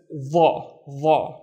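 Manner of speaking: "the" as a thick dark ẓa, backed vowel; heavy emphatic dha. Shown as a demonstration. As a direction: sound out "the" slowly as a heavy Arabic th. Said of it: "The heavier version of the".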